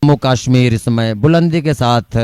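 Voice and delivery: usual speaking voice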